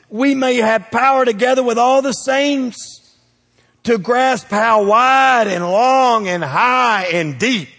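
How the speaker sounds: loud, rising, drawn-out preaching on the apostle's words. Real, not acted. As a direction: preach it out, stretching wide and high. Preaching at full force.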